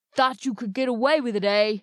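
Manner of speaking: loud, gruff threatening northerner; growled, northern accent. A narrator's reading. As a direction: growl it like a hulking bully. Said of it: "growled the man".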